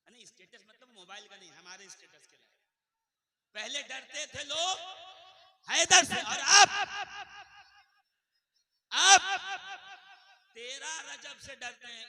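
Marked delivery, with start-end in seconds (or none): 3.6-12.1 loud, high preacher shout; preaching refrain. Acted. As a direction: hurl the refrain at the crowd.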